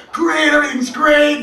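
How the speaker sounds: high, loud mock-cheerful "great"; mock cheery shout. To himself checking the GPS, a mock-cheerful shout. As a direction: sell the fake cheer with a shout. Comedian's ironic inner quote.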